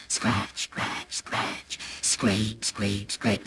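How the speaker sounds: low, soft rhythmic scrape; secretive, half-whispered chant; hushed scratching sounds. A narrator's reading. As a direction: imitate furtive scratching under the breath. Imitates secret scraping at the wall.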